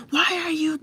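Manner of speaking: high-pitched mock yell; demonstrated screaming. Shown as a demonstration. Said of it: "that's screaming. That's yelling".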